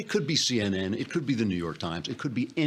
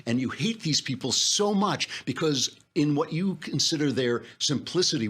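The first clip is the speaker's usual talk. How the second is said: ordinary talk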